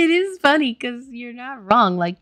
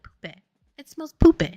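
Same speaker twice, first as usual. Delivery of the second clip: imitated Manchester "poope", ending in "eh"; Manchester accent; 8 semitones higher, 8 dB louder than usual. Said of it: "and be like".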